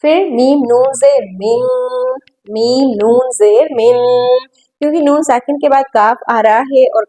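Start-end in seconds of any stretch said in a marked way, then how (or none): 0.3-1.6 "मिन" with a sustained nasal n; ghunna. Shown as a demonstration. Teacher modelling ghunna, "मिन" held through the nose. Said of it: "हमने गुना करना है". 2.5-4.0 "मिन" with a sustained nasal n; ghunna. Shown as a demonstration. Teacher modelling ghunna, "मिन" held through the nose. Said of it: "हमने गुना करना है".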